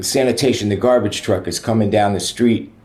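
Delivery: ordinary talk, nothing marked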